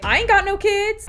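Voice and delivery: very high, loud retort; gleeful declaration. Retold or imitated. Acts out own proud reply.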